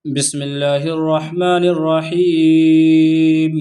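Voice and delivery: slow, melodic tajweed chant; Quran recitation. Acted. Teacher opening the lesson with a chanted verse, slow and melodic. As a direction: recite each verse slowly in a sung tune.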